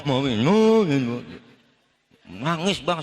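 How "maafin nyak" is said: louder, wailing sobbed pleas, words smeared by crying; hammed-up weeping as he begs forgiveness; mock sobbing apology. Son begging his mother's forgiveness at Lebaran, comic wailing sobs. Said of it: "ngomong sambil nangis kagak jelas ngomong".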